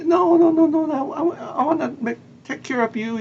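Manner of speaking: high-pitched, acted insistence; insistent refusal. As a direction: brush the protest aside with a high run of no's. Acts chef insisting on cooking.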